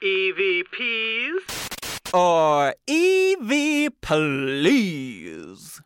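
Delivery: slow drawn-out announcer. Acted. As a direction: boom it out like a show host. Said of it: "game show segment title".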